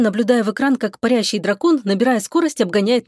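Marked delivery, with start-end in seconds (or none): none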